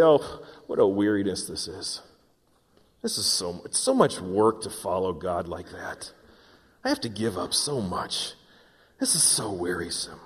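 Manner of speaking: low, soft, weary self-pitying complaint; groaning and moaning. Acted. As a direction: act out moaning under a heavy chore. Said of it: "I groan and moan and say".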